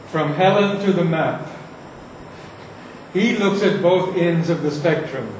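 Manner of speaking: normal talking voice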